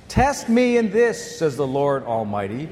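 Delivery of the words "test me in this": "Test me in this" slow, high and forceful; each word of "Test me in this" drawn out and pitched up; emphatic challenge. Acted. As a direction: stretch out "Test me in this" as a bold dare. God's challenge read out emphatically.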